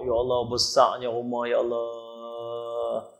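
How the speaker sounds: slow, hushed amazement; drawn-out wondering sigh; awed. Retold or imitated. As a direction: marvel slowly at the big house. Man amazed at the house.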